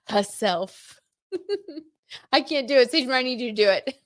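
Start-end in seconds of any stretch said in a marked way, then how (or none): 0.1-1.0 second stab at a New York "Herself"; New York accent. Acted. Tarot reader retrying the word amid giggles, New York accent. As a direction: drop the R, lightly New York. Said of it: "New York accent".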